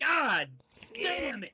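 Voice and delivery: loud, high outburst of frustration; high, exasperated yell; frustrated shout. Acted. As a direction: curse loudly at being caught out. Reacts to a failed deception.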